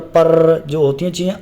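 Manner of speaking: ordinary talk, nothing marked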